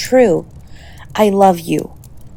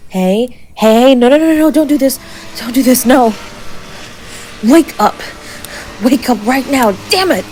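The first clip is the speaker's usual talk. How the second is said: panicked shouting; 6 semitones higher than usual. Acted. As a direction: shout in panic as they slip away. Partner collapses, calling for rescuers.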